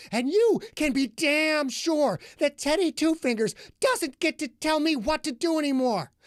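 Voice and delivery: high, loud and fast rant building; furious tirade. A narrator's reading. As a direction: hurl every line at him in rage.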